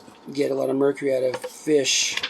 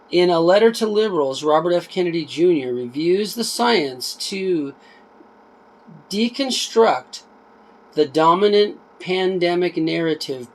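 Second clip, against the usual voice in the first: reading a blurb aloud, a bit livelier than chat; book read aloud; 4 semitones higher, 4 dB louder than usual. Acted. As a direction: read the back cover out to viewers. Reads back cover aloud.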